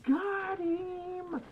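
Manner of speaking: high, elated kill cry; excited yelling. Real, not acted. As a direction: cheer it out triumphantly.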